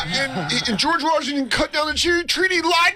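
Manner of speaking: hammed-up tripping rant; goofy high voice. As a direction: babble history facts like a loon. Rambling nonsense history, high voice.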